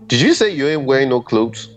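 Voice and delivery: usual speaking voice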